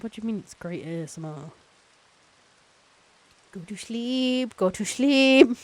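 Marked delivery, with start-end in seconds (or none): 3.5-5.6 high, slow, drawn-out urging building louder; hammed-up anti-lullaby, high and slow; mock sleep command. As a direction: stretch each word, swelling to loud. Mock lulling, growing louder.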